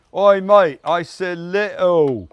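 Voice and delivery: loud, slow cockney telling-off; hammed-up East End shout; East London accent. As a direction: bark at the pot like a London geezer. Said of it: "that was East London".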